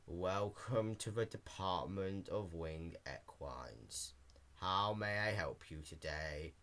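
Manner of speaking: slow, low, lifeless drone; monotone clerk. A narrator's reading. Clerk greeting Dash at the counter, flat bored drone. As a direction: read it like a bored clerk with no feeling. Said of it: "the pony asked in a monotone voice".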